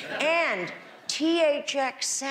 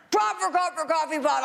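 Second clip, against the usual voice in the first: rattled off fast, a bit higher; quick tongue twister again, pitched up; 7 semitones higher, much faster than usual. Shown as a demonstration. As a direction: race through it with a grin. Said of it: "tongue twisters like that".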